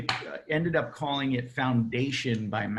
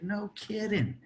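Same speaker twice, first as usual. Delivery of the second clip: amazed disbelief; 9 semitones higher, 4 dB quieter than usual. Retold or imitated. Narrator's stunned reply in story.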